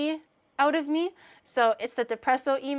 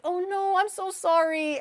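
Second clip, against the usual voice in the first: high, cooing sympathy; sympathetic comforting; 7 semitones higher than usual. Acted. To a crying friend, sympathetic comforting. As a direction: soothe with a raised, tender pitch. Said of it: "I would be like".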